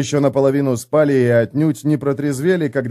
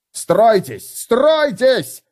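yelling; 10 semitones higher, much slower than usual. Acted. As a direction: scream for the line to form. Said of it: "вопил Хамнер".

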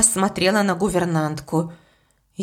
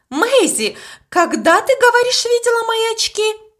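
high, hurried, agitated blurt; a governess blurting out in a high, loud panic; 14 semitones higher, 3 dB louder than usual. A narrator's reading. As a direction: fire the question at her in alarm. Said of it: "выпалила она".

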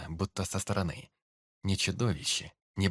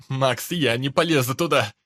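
panicked shout; 4 semitones higher, 10 dB louder than usual. Acted. Terrified brother refusing.

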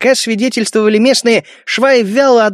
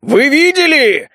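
gleeful shout; 6 semitones higher, slower than usual. A narrator's reading. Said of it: "радостно заорал чёрт".

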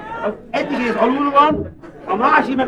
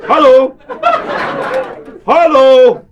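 loud, raised-pitch phone hello; calling hello; 6 semitones higher, 5 dB louder, much slower than usual. Acted. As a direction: call out louder down the phone line.

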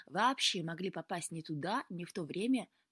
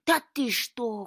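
loud, slow, higher-pitched disbelief; amazed; 4 semitones higher, 9 dB louder, slower than usual. A narrator's reading. Cat hearing Luka's fishing news, wide-eyed amazement. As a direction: stretch it out in astonishment. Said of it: "кот выкатил глаза".

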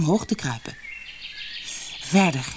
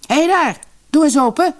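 much higher, loud call; 11 semitones higher, 7 dB louder than usual. A narrator's reading. Helmer calling up to the old woman at the window, loud. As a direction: bellow up at the window. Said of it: "riep hij naar de oude vrouw".

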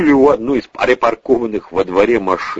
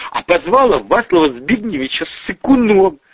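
high-pitched tale-telling; indignant tattling; 9 semitones higher than usual. Acted. Tamara tells on Julia, high and indignant. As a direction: report the insult with outrage.

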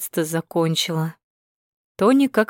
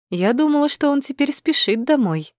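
sweet teasing; 7 semitones higher than usual. A narrator's reading. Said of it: "Голос у Тони сразу стал сладким".

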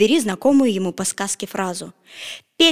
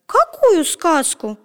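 surprised; 7 semitones higher, much slower than usual. A narrator's reading. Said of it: "удивилась Красная Шапочка".

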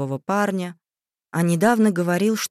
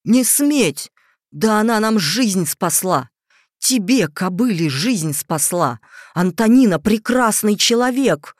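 furious; 4 semitones higher, 6 dB louder than usual. A narrator's reading. Father's furious tirade.

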